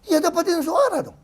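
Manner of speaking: high mocking retort. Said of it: "saya bilang".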